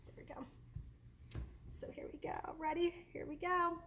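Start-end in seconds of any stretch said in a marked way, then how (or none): 0.0-2.5 whispering. Real, not acted. Adjusting camera, whispering.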